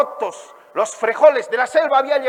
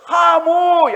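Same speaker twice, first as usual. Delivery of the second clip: calling out; 6 semitones higher, 8 dB louder than usual. Acted. Maize calling other fields.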